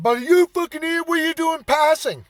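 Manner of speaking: high-pitched mock yelling, imitating an irate driver; retold angry hollering. Irate driver shouts at the man he says is passing, mock hollering. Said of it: "there's this degenerate there screaming".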